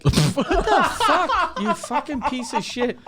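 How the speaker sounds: high, laughing fake fury; laughing mock outrage. Friend retelling a dumb tweet, laughing outrage. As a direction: squeal the insult through laughter.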